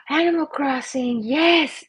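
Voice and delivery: gasp, then high delighted exclamation; excited gasp. Real, not acted. Streamer seizes on viewer's cartoon suggestion, excited gasp.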